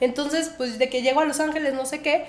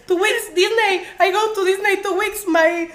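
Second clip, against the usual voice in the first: higher, stumbling tourist English; string English words nervously; broken-English flustered; 6 semitones higher, 4 dB louder, slower than usual. Retold or imitated. Imita su inglés torpe nervioso. (inglés torpe y nervioso de turista)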